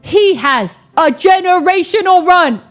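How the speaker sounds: high, jubilant storytelling; thrilled. Retold or imitated. Recounting a generational craps run, thrilled. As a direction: build the hype with rising glee.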